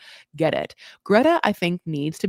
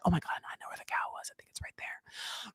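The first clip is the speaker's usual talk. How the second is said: fast excited whisper to yourself; 14 dB quieter than usual. Pretend. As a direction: whisper like you've cracked the secret. Secret realisation in memory game.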